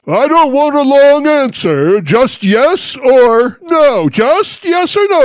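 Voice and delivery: loud, high mock grandstanding politician; interrupting senator. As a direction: cut the witness off, pitched high and loud. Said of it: "interrupting them the moment two words come out".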